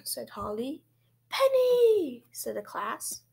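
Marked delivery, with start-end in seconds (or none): 1.3-2.2 high, loud chorus-style reply; called-out answer. A narrator's reading. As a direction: sing out the name like an eager class. Class shouts out answer.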